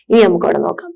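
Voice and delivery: ordinary delivery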